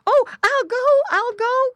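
very high, loud pleading squeal; crying piglet. A narrator's reading. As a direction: give in with a high piglet cry. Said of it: "cried Squinty".

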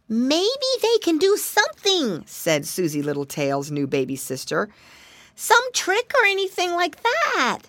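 put-on high little girl; baby girl voice. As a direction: suggest it like a tiny girl. Baby sister speaks.

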